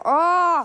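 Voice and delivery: high, loud startled Oh; loud surprised shout. Real, not acted. Sudden loud exclamation.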